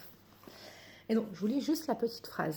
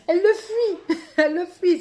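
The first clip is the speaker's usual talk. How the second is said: laughing; 6 semitones higher, 9 dB louder than usual. Real, not acted. Laughing at her own slip.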